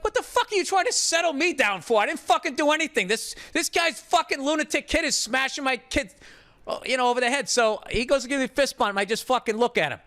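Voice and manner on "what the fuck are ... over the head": high, heated retelling of a furious rant; angry rant. Retold or imitated. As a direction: fire it back at him, outraged. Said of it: "I'm like".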